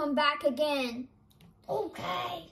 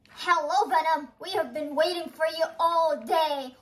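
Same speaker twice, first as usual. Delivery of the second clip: ordinary talk, nothing marked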